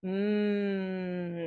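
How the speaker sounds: "mm" held long with lips closed; M sound. Shown as a demonstration. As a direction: hum a steady, drawn-out "mm". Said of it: "So close the mouth".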